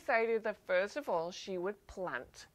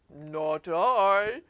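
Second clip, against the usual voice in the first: low cow voice; 2 semitones lower, 5 dB louder than usual. Acted. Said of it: "The cow says in the low voice".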